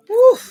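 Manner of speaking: loud, very high whoop; excited whoop. Real, not acted. Whooping with joy while cooling down on stream. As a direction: throw out a wild happy whoop.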